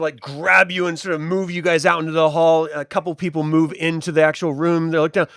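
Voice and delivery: ordinary delivery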